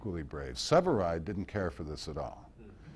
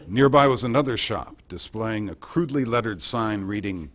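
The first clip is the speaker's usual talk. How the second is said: louder wartime dispatch read, slowing on the sign; reading his broadcast; 6 dB louder than usual. Retold or imitated. To the interviewer, quoting Murrow's London report, louder and measured. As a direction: paint the street like a radio reporter. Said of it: "I gotta give you a little taste".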